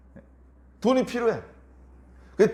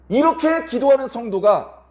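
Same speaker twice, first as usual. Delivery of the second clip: loud, slow and raised; emphatic preaching; 7 semitones higher, 6 dB louder, slower than usual. Real, not acted. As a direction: press each word home. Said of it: "emphatic slow preaching". (힘주어 천천히 외치듯)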